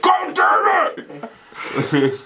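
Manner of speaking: drawn-out, very slow mock yell; mock screaming curse. After hitting his head, hammed-up slow screaming curse. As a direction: drag out the curse like dad. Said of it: "in a deep, like, screaming voice".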